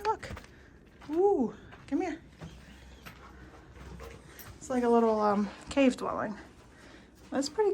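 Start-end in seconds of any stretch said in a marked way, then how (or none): 0.0-2.2 excited. Real, not acted. Excited calling dog to cave.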